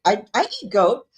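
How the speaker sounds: ordinary talk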